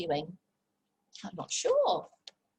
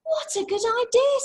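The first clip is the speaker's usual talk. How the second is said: high, loud eager Zog; excited dragon reply; delighted Zog; 13 semitones higher, 5 dB louder than usual. Acted. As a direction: jump at the idea. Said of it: "said Zog".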